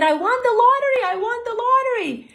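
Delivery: loud, high-pitched victory yell; excited screaming. Retold or imitated. Au pair telling the house she won, screaming. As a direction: scream the news in sheer excitement. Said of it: "So I started screaming".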